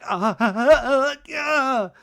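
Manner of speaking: high, rising hammed-up sobs; mock sobbing. Imitating Nia breaking down internally, mock sobbing. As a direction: fake broken sobs with a laugh in them. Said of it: "Nia's, like, breaking down internally".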